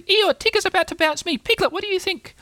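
very high, squeaky excitement; piping, thrilled little animal; eager. A narrator's reading. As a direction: beg for a turn in falsetto. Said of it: "said Roo eagerly".

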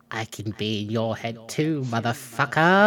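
low, quiet taunt; softly menacing reply; hushed secret message. Acted. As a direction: send a hushed threat back, voiced low. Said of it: "pointing at each other and whispering".

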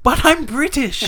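high, drawn-out British exclamation. Acted. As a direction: stretch it out as a hammed-up Brit.

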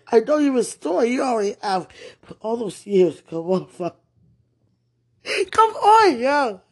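loud sobbing prayer; crying plea. Real, not acted. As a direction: plead through sobs.

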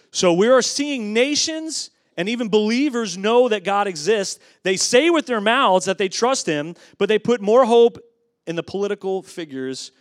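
loud, high, driving delivery; fervent preaching. Real, not acted. Preacher telling the church where believers put their hope, fervent preaching. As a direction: press the charge home with force.

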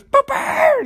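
very high squeaky cry; mock falsetto yelp; silly squeal. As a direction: squeak it like a cartoon.

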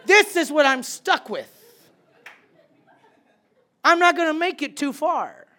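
high, loud mock despair with a laugh; loud joking lament about the team; loud complaint. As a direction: shout the joke to the team. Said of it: "and I'm saying".

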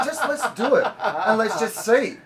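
high, loud, grandiose urging to friends; hamming up a wild plan to the group; mock grand pitch. Talking friends into a month-long trip, mock grand pitch. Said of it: "and I said".

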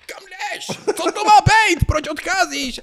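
high, shrill-edged mock girlfriend yelling; girlfriend's outburst. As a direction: play the upset girlfriend, voice jumping way up. Girlfriend voiced in retold quarrel.

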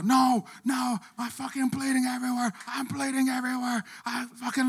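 high panicked put-on woman's voice on a loop; hysterical woman again. Retelling her looping panic in a high frantic voice. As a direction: wail it like she can't stop. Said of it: "she said the same thing over and over".

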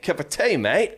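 squeaky hammed-up Australian accent. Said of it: "imagine an Australian person".

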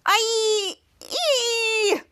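high squeal, a yelp in two parts. Retold or imitated. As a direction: let out a sharp high squeal. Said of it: "Schwartz squealing".

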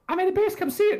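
put-on high eager kid voice; little kid voice. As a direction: sound like an excited child. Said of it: "He's like".

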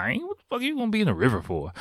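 high, fast, disbelieving outburst; incredulous outburst. Retold or imitated. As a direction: blurt it out like the idea is absurd. City kid refuses river rafting.

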